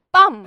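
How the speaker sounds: sudden, high, very loud "pam"; loud imitated blow. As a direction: burst out like a smack on the head.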